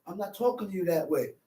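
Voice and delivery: a hint of a patient man keeping cool; lightly voiced calm man pleading quietly; calm restrained man. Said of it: "holds his composure and say".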